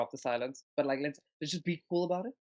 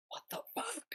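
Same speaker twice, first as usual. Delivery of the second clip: high, hushed squeal of amazement; gleeful, high and under the breath; 14 semitones higher, faster than usual. Acted. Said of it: "he goes up to Quoth and he's like".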